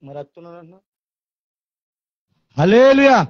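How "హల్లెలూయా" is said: high, loud hallelujah; hallelujah shout. Real, not acted. Preacher calls to the church, loud and high. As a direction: rouse the congregation.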